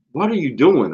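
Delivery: high, loud mock-panicked student; imitating alarmed girl. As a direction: imitate a girl questioning her teacher in alarm. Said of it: "one of the girls was like".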